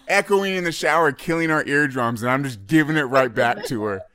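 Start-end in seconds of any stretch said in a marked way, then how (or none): none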